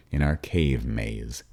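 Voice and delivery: plain reading voice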